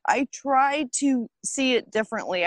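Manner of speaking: ordinary talk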